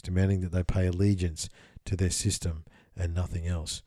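measured, solemn reading voice; steady scripture-style reading; reading a channeled text. Acted. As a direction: read it out like old scripture. Reads the text aloud.

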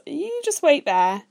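high, slow, drawn-out tease; teasing instruction. A narrator's reading. Brother leaving the room, teasing as he goes. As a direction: draw it out loudly and playfully. Character teasingly leaves the other waiting.

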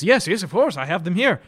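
loud, high, eager to please; eager Siegfried. Acted. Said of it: "He says".

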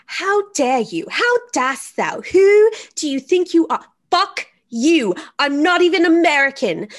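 hammed-up furious rant at the phone; mock outrage. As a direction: play Cat Lady yelling at a robocall. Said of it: "And she's like".

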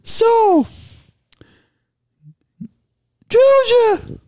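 high squeaky pretend voice; put-on high pet voice. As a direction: voice the show like a little pet. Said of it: "in our voices".

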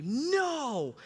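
high, strained panicked voice. Retold or imitated. Boy realizing he broke the window, high panicked self-talk. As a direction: replay the moment dread hits. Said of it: "and I think".

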